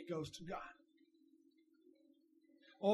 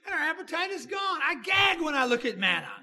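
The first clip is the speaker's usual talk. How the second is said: whiny complaint; 8 semitones higher, 10 dB louder than usual. Acted. Israelites complaining about manna.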